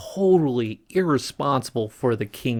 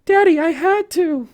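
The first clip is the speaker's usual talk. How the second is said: put-on high, loud girlish plea; Ariel voice; 5 dB louder than usual. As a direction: insist in a falsetto daughter's voice.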